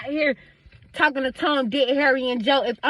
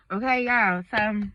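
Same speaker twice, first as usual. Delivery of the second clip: ordinary talk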